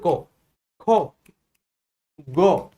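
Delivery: "कौ, खो, गो" with rounded o vowels, spaced apart; Bihar pronunciation. Retold or imitated. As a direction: round each letter into an o, Bihar style. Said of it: "Bihar side की तरफ जाओगे".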